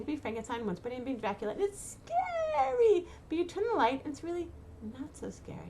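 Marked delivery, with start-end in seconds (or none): 1.5-3.0 very high, slow and drawn-out; mock spooky fright. Spooking listeners with the haunted house, mock fright. 5.0-5.6 much softer, calm letdown; soft deflation. Real, not acted. Calming listeners after the fake scare, softly.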